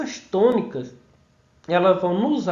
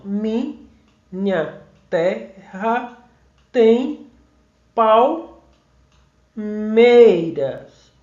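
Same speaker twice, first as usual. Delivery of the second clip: "Minha terra tem palmeiras" syllable by syllable with long pauses; very slow, separated syllables, "palmeiras" stretched on MEI; counting out syllables; 4 dB louder, much slower than usual. Shown as a demonstration. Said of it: "vamos contar aqui".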